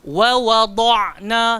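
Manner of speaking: "Wa wada'na" very slow, the d heavy with the whole tongue; model recitation. Shown as a demonstration. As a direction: recite for the class to copy. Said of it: "Cuba sebut".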